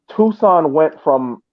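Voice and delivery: ordinary talk, nothing marked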